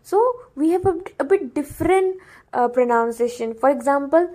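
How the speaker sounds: ordinary talk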